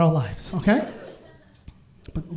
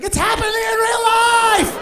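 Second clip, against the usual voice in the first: high, loud shout of disbelief; excited shout; 14 semitones higher, 6 dB louder than usual. Retold or imitated. Coworkers watching HR scold him, excited shout. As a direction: yell it like history is unfolding. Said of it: "Everyone's like".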